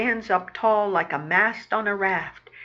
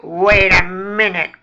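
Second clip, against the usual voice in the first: loud rat growl at normal pitch; 9 dB louder than usual. Acted. Boss rat stops his pal grabbing the frog, growling. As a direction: growl it to halt him. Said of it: "growls Fats".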